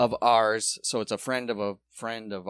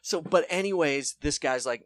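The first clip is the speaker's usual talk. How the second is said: ordinary talk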